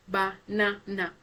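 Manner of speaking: ba-na-na slowly, one syllable at a time. Shown as a demonstration. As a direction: break banana into ba, na, na. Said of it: "So the second syllable is na".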